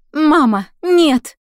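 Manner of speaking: high, very loud scream. Acted. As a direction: scream in horror for her mother. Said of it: "крикнула Элиза".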